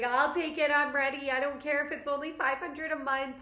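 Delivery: eager and excited; eager excitement. Retold or imitated. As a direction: blurt it out, ready to go. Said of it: "So I was like".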